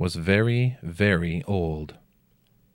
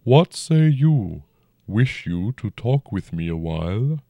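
dragon voice; 3 semitones higher, 4 dB louder than usual. A narrator's reading. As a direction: speak as a huge old dragon, unhurried. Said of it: "the dragon said".

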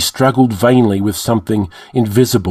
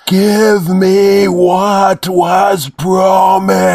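high, loud, very slow monstrous shout; more powerful demand; 10 semitones higher, 4 dB louder, much slower than usual. A narrator's reading. Demon roaring at the priest for its promise. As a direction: bellow the demand with full force. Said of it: "more clearly and powerfully".

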